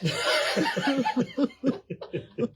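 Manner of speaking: high squealing laugh; helpless laughter. Real, not acted. Laughing at her own joke after telling it. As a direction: breaks into giggles at own punchline.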